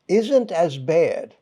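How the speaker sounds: ordinary talk, nothing marked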